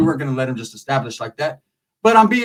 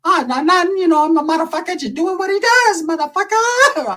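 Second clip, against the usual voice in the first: Mister Chow; 13 semitones higher than usual. Retold or imitated. Mister Chow: Shorty shrugging off the question, Chow style. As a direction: play it like the Hangover gangster. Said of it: "Sounding just like the fucking dude from Hangover, Mister Chow".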